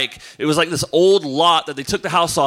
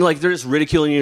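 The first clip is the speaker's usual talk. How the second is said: ordinary delivery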